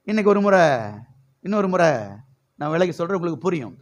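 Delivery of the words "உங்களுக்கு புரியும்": usual speaking voice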